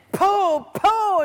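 loud, high imitated blows; explosive comic-book hits; punching sound effect. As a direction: land each punch with your voice.

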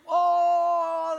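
high, loud mocking "Oh"; mocking shout. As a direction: jeer at him with a loud high cry. Portuguese guy laughs at Japanese.

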